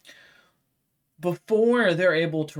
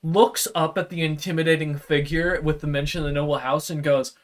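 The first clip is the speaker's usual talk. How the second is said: usual speaking voice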